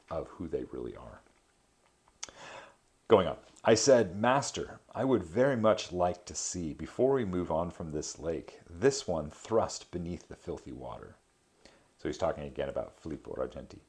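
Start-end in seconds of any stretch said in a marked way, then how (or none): 3.1-11.1 measured reading of an epic poem. A narrator's reading. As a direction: read the verse with gravity. Reads Dante translation aloud.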